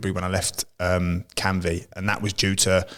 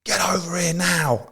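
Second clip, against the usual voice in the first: imitated scream; 10 semitones higher, slower than usual. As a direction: imitate a sergeant major screaming, pitched right up. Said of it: "screamed at the top of his voice".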